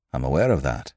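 low, understated grim reply; rather grim. A narrator's reading. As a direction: answer grimly, voice dropped. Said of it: "he answered rather grimly".

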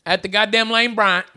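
usual speaking voice

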